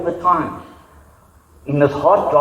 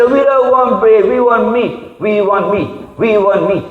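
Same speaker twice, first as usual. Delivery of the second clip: whiny demand; 5 semitones higher, slower than usual. Retold or imitated. The crowd whining at Moses for meat. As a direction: complain like spoiled children.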